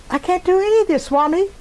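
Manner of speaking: high, pleading cry to Swami; a mother's tearful prayer for her son; tearful plea. Retold or imitated. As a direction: beg through tears, voice lifted high. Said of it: "And I'm crying".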